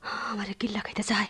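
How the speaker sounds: fast, breathless whisper of dread; frightened whisper. Acted. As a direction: whisper it as if the danger can hear. Woman in terror at storm. (ভয়ে দ্রুত ফিসফিস)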